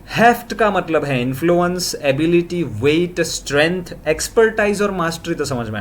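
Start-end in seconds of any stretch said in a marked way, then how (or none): none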